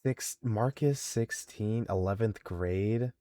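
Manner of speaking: normal talking voice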